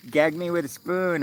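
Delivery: put-on high-pitched Valley Girl catchphrase. Shown as a demonstration. Streamer giving an example of Valley Girl talk, high Valley Girl voice. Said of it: "what Valley Girl is".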